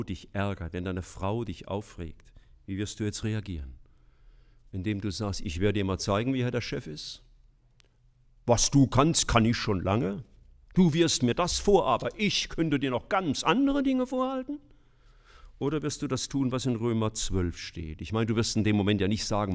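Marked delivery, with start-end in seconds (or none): 5.4-14.6 rising, louder indignant husband; mock angry retort. Husband snapping back at his nagging wife, mock indignant. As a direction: snap back like a wounded husband.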